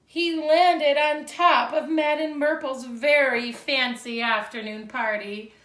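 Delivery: put-on lively read-aloud for young children; storybook reading. A narrator's reading. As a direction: read it like a picture book to second graders. Teacher reads picture book aloud.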